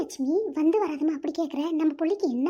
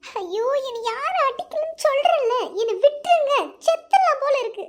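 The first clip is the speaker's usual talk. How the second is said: anguished outburst; 5 semitones higher, 5 dB louder than usual. Acted. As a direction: wail it out in despair. Sobbing outburst at lover. (காதலனிடம் அழுகையுடன் கதறல்)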